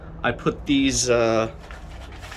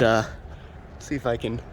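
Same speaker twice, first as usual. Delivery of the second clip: usual speaking voice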